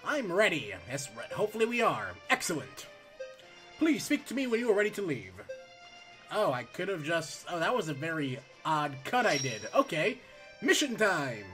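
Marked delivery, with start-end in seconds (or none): none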